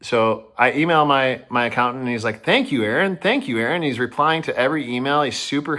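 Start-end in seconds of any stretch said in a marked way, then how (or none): 2.4-3.8 higher, chirpy and bouncy thanks; overly upbeat thanks, lightly imitated; imitating a bubbly thank-you. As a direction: play the suddenly cheerful accountant. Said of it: "He's super bubbly".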